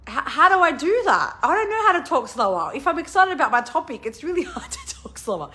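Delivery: high-pitched, mock-helpless protest; flustered protest. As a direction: plead it high, laughing by the end. Retells flustered reply to editor's request.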